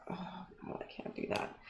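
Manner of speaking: hushed whisper to himself. Real, not acted. Streamer whispering to himself as he fumbles the entry list. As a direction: whisper it under your breath.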